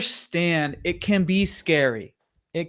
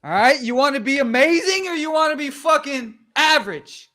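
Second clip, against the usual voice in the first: fired-up hype; 9 semitones higher than usual. Real, not acted. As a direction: pump them up like a coach.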